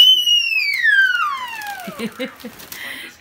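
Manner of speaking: long, very high, loud squeal. Real, not acted. Child screams over a new present, delighted squeal. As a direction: shriek with delight and hold it. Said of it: "Miren la gritadera que se tiene".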